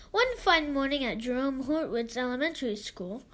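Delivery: usual speaking voice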